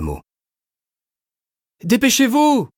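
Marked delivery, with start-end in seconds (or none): 1.8-2.7 high, loud desperate plea; pleading shout. A narrator's reading. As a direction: beg them to hurry, loudly. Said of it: "implora l'une des infirmières".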